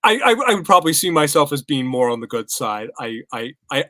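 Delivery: ordinary delivery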